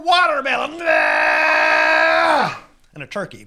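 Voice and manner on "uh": huge low holler, far louder than the telling; drawn-out holler. Acted. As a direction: let out one long, full-throated yell. Long howl in the tale.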